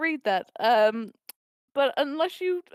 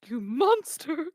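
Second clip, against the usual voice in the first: imagined accusation; much slower than usual. Acted. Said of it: "they'll be like".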